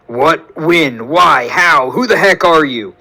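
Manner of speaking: bewildered patient demanding answers; frantic questions. Acted. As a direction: fire them off in alarm. Woke up confused, questioning stranger.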